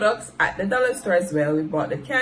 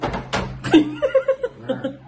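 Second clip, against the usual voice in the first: high laugh. Real, not acted. Laughing at husband.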